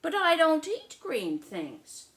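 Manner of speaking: high, loud, slow refusal; stubborn little sister. Acted. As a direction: dig your heels in like a picky kid. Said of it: "said Lola".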